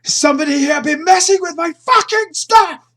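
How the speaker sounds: screamed very high and very loud; tantrum scream. Acted. Sergei screams at the crew in a tantrum, very high and loud. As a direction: throw a tantrum at the whole room. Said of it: "Starts screaming".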